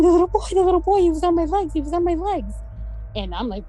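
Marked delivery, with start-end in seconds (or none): 0.0-2.5 retold frightened scream. Girl woken by a ghost tells the sisters, high and panicked. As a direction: mimic a terrified girl. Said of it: "Damaris is screaming".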